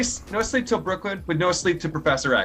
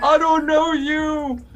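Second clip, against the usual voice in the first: put-on old man voice; 12 semitones higher, 6 dB louder, much slower than usual. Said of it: "do it with your old man voice".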